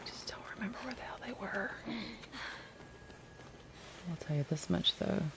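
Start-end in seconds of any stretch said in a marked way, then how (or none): 0.0-1.7 fast breathy whisper to herself. Real, not acted. Streamer whispering to herself mid-game. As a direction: hiss it under the breath. Muttering aside while gaming.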